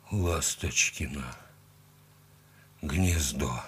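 slow, soft, low wheeze; wounded man rasping out a password; hoarse croak. A narrator's reading. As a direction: croak it faintly, with a long gap between words. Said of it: "прохрипел он".

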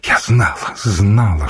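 ordinary delivery

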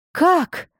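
loud high shout. A narrator's reading. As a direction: scream out in shock. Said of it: "заорала Дашка".

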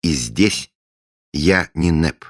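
stern. Acted. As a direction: insist with dignity. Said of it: "был строгий ответ".

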